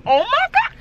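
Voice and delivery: high mock gasp of amazement; put-on high-pitched astonishment; imitated shock. Quoting her reaction to the age, high mock shock. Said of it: "She was like".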